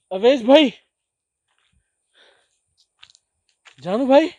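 high, very loud cries for help; panicked calling. Real, not acted. Explorer left alone calling his companions, panicked shouts. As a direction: yell their names into the dark, panicking.

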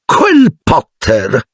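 a high, slow, drawn-out call; loud drunk aunt. A narrator's reading. Drunk aunt Marge calls out loudly over her brandy. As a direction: boom the name across the table. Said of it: "disse zia Marge ad alta voce".

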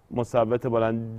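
ordinary talk

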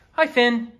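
usual speaking voice

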